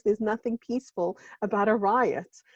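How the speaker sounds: ordinary talk, nothing marked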